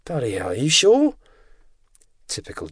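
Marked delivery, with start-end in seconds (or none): 0.1-1.2 incredulous, high swooping question; exasperated query, pitch leaping up at the end; put out. A narrator's reading. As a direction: ask it high and irritated, disbelieving. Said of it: "George Savage sounded put out".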